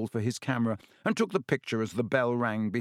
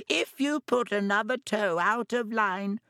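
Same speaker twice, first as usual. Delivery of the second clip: high mock-mum voice; Malfoy mocking Ron's mother; shrill mocking mother; 11 semitones higher than usual. A narrator's reading. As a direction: mimic a fussing mother. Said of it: "He put on a shrill, piercing voice".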